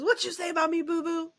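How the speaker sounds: high, indignant dog voice; voicing the old corgi. Old corgi confronting Boo Boo, high indignant voice.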